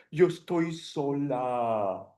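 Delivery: slow, sorrowful, hammed up; a touch of fake weeping; mock tearful. Teacher example with tears in her eyes, mock sad. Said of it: "with tears in your eyes".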